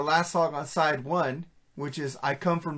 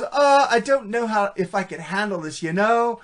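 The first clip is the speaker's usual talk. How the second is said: put-on Valley Girl voice; 5 semitones higher, 3 dB louder than usual. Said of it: "She goes".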